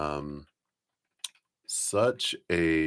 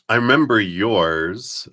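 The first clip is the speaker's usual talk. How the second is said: ordinary delivery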